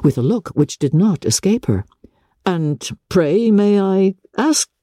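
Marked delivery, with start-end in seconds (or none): 2.5-4.7 slow, higher question left hanging; drawn-out, suspended inquiry; checking himself. A narrator's reading. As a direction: begin to probe, then stop short. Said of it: "but checking himself".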